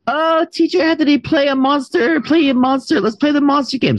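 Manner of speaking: put-on high, excited child begging to play; imitating a small kid. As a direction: beg like an eager preschooler. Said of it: "the kids will be like".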